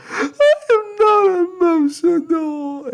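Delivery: very high, loud sobbing yell; crying shout. Acted. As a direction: scream the denial through tears. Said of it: "I shout".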